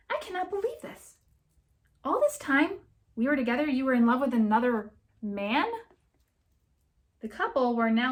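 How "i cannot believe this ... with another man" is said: outraged. Acted. Betrayed girlfriend's outburst.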